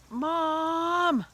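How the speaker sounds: very high, very loud cry; embarrassed yell. Retold or imitated. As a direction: yell across the steps at mom. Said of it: "I heard William yell".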